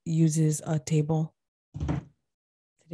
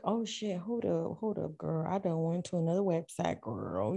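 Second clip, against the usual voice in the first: much softer, muttered to herself; quiet aside; 9 dB quieter than usual. Real, not acted. Searching a website while her cohost waits, quiet aside. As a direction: drop the voice, distracted by the screen.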